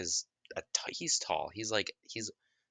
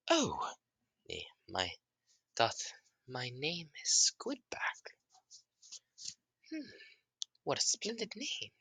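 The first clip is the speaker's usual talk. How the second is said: awed creature; 8 semitones higher than usual. Acted. Creature delights in new name.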